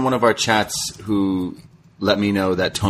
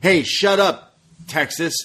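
retold heel taunt, raised and slowed at the end; mock yell; 6 semitones higher, slower than usual. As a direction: bark it at the crowd like a heel. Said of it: "she's then yelling at the crowds".

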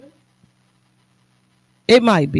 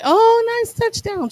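wife cheering; 13 semitones higher, slower than usual. Retold or imitated. Wife's cheer imitated by husband.

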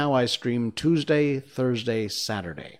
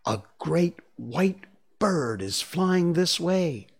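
high, slow announcement full of wonder; slow, marvelling boy's voice; wondering cry; 6 semitones higher, slower than usual. Acted. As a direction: draw it out, amazed at the sight. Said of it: "he cried".

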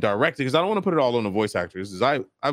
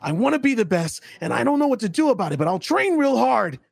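higher, eager anime hero voice; generic anime hero; 9 semitones higher than usual. Shown as a demonstration. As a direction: chirp it up like every shonen lead. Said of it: "every anime person sounds like this".

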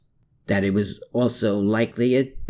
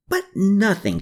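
snapped; 10 semitones higher, 3 dB louder than usual. A narrator's reading. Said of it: "Captain Riedel snapped".

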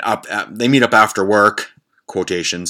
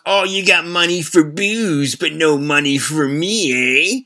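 slow, higher mocking beggar's jab; sneering beggar voice; 6 semitones higher, slower than usual. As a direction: needle him slowly, hammed-up street woman. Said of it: "she responds".

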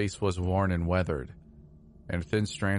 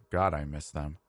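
choked up; 4 semitones lower than usual. A narrator's reading. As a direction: let the words catch and fall away. Narrator grieving his parents.

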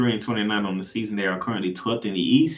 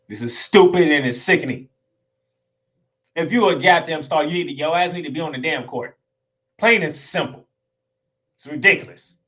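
loud, higher, fed-up scolding; angry rant; 5 semitones higher, 6 dB louder than usual. Real, not acted. Fan ranting at stars who sit out, angry and loud. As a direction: lay into lazy stars, heated.

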